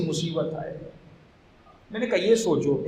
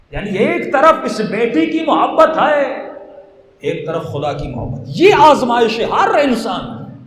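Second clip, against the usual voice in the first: rising, louder and higher, pleading with the crowd; impassioned preaching; 5 semitones higher, 6 dB louder than usual. Acted. Preacher urging the gathering, building to a loud impassioned plea. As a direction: preach it to the mourners, building in force.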